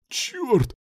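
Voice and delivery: high, pained-sounding outcry; exclaiming. A narrator's reading. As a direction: cry out sharply high, without extra volume. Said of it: "воскликнул Руслан". (высокий вскрик)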